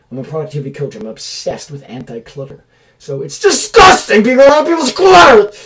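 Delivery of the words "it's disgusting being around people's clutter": much higher, louder shriek of disgust; disgusted outburst. Real, not acted. Coach ranting at clutter, shrieking in disgust. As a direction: squeal the disgust out loud.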